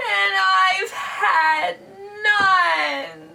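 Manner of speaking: high, wailing storybook bear; whimpering bear. Acted. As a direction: complain in a tearful, hammed-up wail. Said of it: "And he whimpers".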